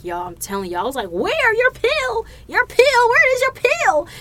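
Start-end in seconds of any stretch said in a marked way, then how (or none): 1.1-4.1 frantic high-pitched mock questioning; retold panic. Telling y'all about being in a panic, comic shrieked alarm. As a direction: keep yelping it, loud and hammed up. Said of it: "I was in a panic".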